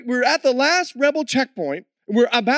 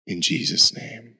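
closing prayer; 3 dB quieter than usual. Acted. Preacher leading the church in prayer, hushed and low. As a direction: lead the congregation into prayer, hushed.

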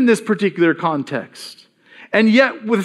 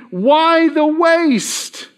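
slow, higher, drawn-out reproach; indignant scolding; 7 semitones higher, much slower than usual. Acted. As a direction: let the indignation drag each word. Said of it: "Indignant, saying".

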